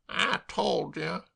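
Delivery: slow, sombre mutter; somberly. Acted. Molloy to Dolly, sombre. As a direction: say it with gloom. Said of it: "said Mister Molloy somberly".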